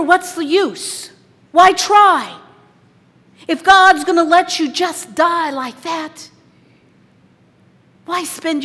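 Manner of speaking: loud, high, slow questioning; a believer shouting his questions to heaven; anguished shout at God. Acted. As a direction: cry it out in protest. Voicing cynical questions at God.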